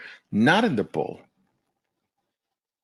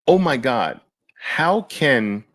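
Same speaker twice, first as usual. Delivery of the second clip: normal talking voice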